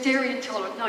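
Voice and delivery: normal talking voice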